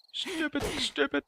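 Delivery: high, squeaked frustrated repeat; high-pitched exasperated stupid; squeaky frustration. Real, not acted. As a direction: squeak it out in annoyance.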